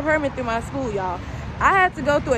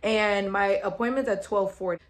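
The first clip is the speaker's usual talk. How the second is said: ordinary talk, nothing marked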